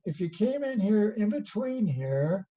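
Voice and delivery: normal talking voice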